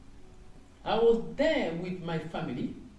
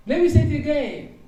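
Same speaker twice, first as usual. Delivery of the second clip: higher, louder and quick, carried away; excited call; 9 semitones higher, 10 dB louder, faster than usual. Real, not acted. Preacher to the congregation, exclaiming loudly and fast. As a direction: burst out with fresh excitement.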